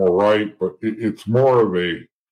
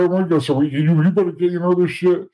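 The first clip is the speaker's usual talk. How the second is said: higher, revved-up mock eagerness; eager to fight; 8 semitones higher than usual. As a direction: wound up and itching to go.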